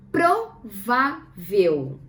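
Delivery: PRO, VÁ, VEL, slow and separated; "Provável" as pro... vá... vel; syllable by syllable. Shown as a demonstration. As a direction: spell out "Provável" in three spaced syllables. Teacher sounds out the word.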